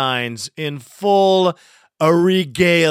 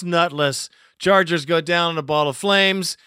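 ordinary talk

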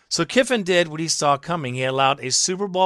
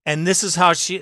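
ordinary talk, nothing marked

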